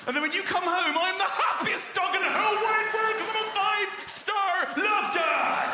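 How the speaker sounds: very high, loud, ecstatic Norfolk dog; overjoyed dog shout. Acted. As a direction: yell it like the happiest dog alive. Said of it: "give him a Norfolk accent".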